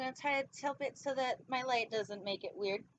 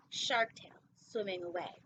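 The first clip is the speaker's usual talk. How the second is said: ordinary delivery, nothing marked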